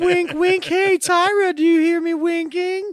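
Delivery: very high, loud, drawn-out wink-wink; hammed-up hinting. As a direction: make the secret signal comically obvious. Acted obvious hint to Tyra.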